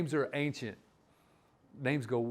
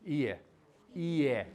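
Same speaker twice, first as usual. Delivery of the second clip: said slow. Shown as a demonstration. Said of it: "But if you say it slow".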